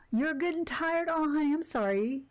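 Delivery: high-pitched cooing sympathy; sweet sympathy. Real, not acted. Streamer comforting a tired one, sweet high sympathy. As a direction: coo it like comforting a little one.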